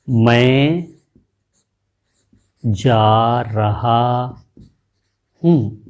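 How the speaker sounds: drawn out, word by word with long gaps; slow, broken-up demo of मैं जा रहा हूँ. Shown as a demonstration. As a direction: stretch each word apart slowly. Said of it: "ये आपको एक पूरी ध्वनि सुनाई दी".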